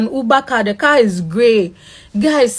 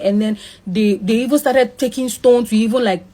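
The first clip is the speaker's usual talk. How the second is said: normal talking voice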